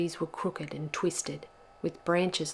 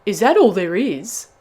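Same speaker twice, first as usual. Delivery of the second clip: loud, swooping incredulous question; indignant disbelief; 3 semitones higher, 13 dB louder than usual. A narrator's reading. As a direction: demand it in disbelief. Bubba appalled at one cake.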